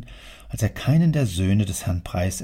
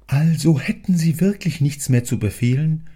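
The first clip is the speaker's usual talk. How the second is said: higher, louder fawning clerk voice; servile question; 6 semitones higher, 5 dB louder than usual. Acted. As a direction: ask eagerly if there is more. Clerk asks his master.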